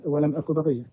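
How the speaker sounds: usual speaking voice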